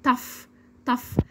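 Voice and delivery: "tough" short, ending in f. Shown as a demonstration. Teacher drills learners, short "tough" ending in f. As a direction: drill "tough" with a final f sound. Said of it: "نسمع الكلمات ونردد".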